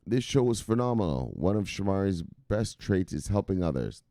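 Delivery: usual speaking voice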